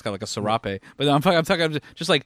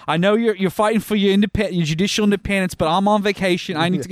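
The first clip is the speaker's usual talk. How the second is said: higher, louder mock British TV host; a tourist shrugging off the protests in a mock host voice; John Oliver joking about his holiday, high and loud; imitating John Oliver; 8 semitones higher, 4 dB louder than usual. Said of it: "He's like".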